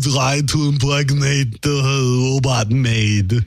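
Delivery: slow, drawn-out action hero voice; Arnold impression. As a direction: lumber through it like Arnold. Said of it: "now I'm in the voice that I can't get out".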